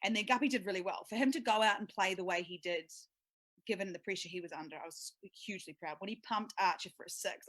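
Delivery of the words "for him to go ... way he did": normal talking voice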